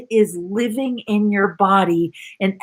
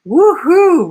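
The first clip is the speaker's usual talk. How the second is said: a high, loud whoop; cheering woohoo; 8 semitones higher, 7 dB louder than usual. Real, not acted. Coach cheering a student's comment, whooping.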